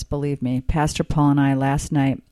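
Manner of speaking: usual speaking voice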